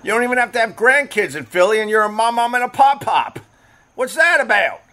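high-pitched mock Philly; exaggerated Philly vowels, high voice; Philly accent. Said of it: "Can't figure out the Philly accent".

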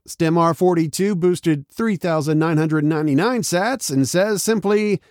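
ordinary delivery

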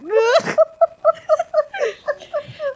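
long high laughing fit; can't stop laughing; helpless laughter. Real, not acted. Trying to get back into character, high laughing fit.

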